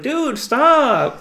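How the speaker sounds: high, loud retold yell; calling off the dog.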